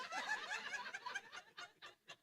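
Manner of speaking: soaring high laugh; big high laugh. Real, not acted. Father cracking up at his own impression, high laugh. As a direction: let out a long squealing laugh.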